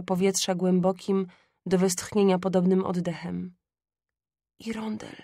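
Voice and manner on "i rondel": very soft, effortful murmur, falling; ailing mother forcing out words faintly; faint and hushed, with effort; strained whisper. A narrator's reading. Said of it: "szepnęła z wysiłkiem".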